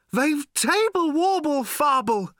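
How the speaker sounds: put-on high, loud, slow quavering old man; thin tremulous shout. Slarty shouting to Ford and Arthur, thin tremulous shout. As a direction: wobble it out at full volume. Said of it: "shouted Slarty Bartfast again".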